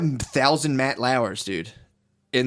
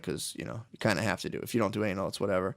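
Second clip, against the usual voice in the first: mock casual mutter; 5 semitones lower, 9 dB quieter than usual. Voicing the porn producer casually.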